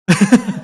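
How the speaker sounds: short chuckle, a little high; amused laugh. Real, not acted. Storyteller amused by a customer's petty revenge, short laugh. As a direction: break into a brief real laugh.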